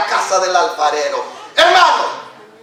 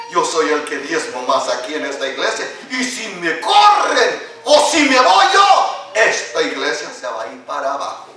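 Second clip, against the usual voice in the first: lightly voiced smug tither, half laughing; a hint of a bragging church member; boastful tither; 5 semitones lower than usual. Pastor mocks a proud tither to the congregation, lightly voiced. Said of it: "decía una persona, dice".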